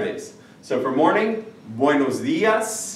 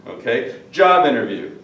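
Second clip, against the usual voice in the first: usual speaking voice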